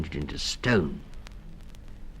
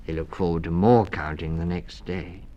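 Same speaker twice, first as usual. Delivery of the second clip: ordinary delivery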